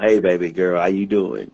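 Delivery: flirty. Pretend. Greets woman joining, flirting.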